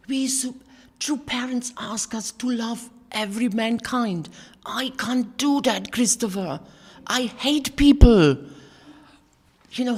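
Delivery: blurted distress. Retold or imitated. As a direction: pour out the confession to your son. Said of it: "And I blurred out".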